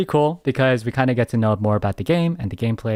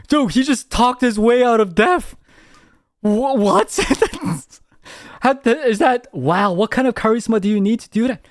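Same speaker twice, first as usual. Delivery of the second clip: amazed; 8 semitones higher than usual. Real, not acted. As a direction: gush in disbelief. Amazed reaction.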